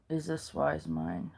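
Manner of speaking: quiet self-questioning murmur; hushed inner question. Shown as a demonstration. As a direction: barely voice it, as if asking yourself silently. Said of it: "silently ask".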